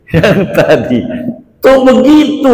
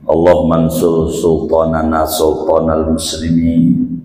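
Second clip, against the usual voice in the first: prayer recitation; 9 semitones lower than usual. Shown as a demonstration. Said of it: "Begitulah kita doa".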